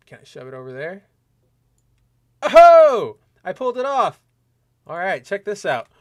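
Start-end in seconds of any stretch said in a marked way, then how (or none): 2.4-4.2 loud, high triumphant outburst; triumphant surprise. Real, not acted. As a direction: burst out high as it finally works. Celebrates fixing his on-screen setup.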